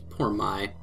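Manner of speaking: usual speaking voice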